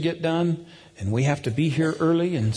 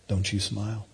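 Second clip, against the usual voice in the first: low, hushed mock warning; mock-stern command; 5 semitones lower, 5 dB quieter than usual. Dad daring his moody son, low mock-stern. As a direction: playfully forbid it in a lowered voice.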